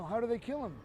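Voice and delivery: usual speaking voice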